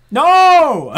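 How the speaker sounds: very high, loud shocked No; playful shriek. Real, not acted. Reader shrieking at the pig analogy. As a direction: cry out in mock protest.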